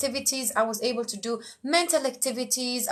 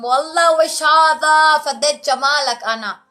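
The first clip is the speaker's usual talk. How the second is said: gushing self-praise; 4 semitones higher, 9 dB louder than usual. Pretend. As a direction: admire yourself in the mirror out loud. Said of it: "looked at yourself in the mirror and said".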